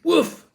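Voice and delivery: high sharp woof; loud yappy bark; dog bark. A narrator's reading. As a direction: bark at the coffin. Dog barks at coffin.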